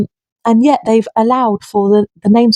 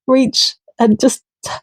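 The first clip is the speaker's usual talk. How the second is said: ordinary talk, nothing marked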